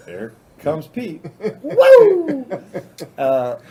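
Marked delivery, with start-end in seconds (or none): 1.6-2.5 high, loud "woo"; mock flyby whoop. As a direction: whoop like a rider sailing past. Acting out Pete flying off ramp.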